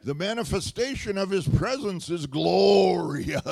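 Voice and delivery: churchy King James prayer. Pretend. Preacher mocking a churchy prayer, booming and lofty. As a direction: ham up a pompous church prayer. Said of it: "your churchy King James prayer".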